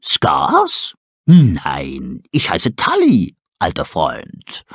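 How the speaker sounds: owl voice. Acted. Tully telling the wolf his real name, slow. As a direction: insist kindly on the name.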